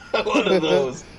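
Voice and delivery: laughing through it; laughing reply. Retold or imitated. Dale answering the girl, laughing. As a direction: laugh out the words. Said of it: "And he's like".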